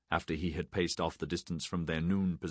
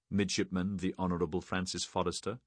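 ordinary delivery, nothing marked

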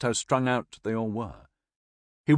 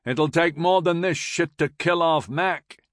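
high, slow, raised jibe; called back; 7 semitones higher, 4 dB louder than usual. A narrator's reading. Major to the corporal, jibing to break the ice, calling back. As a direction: call it back, drawn out and pitched up. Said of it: "Downes called back".

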